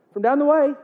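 high, questioning Irish lilt; mock Irish accent. Buddy asks which Patrick is meant, mock Irish accent. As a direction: check who is meant, in put-on Irish. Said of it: "I'm gonna get that accent".

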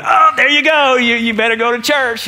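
high, loud gloating taunt; mocking sing-song gotcha; mocking jab. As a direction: needle the roommate for his cuss. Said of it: "And I went".

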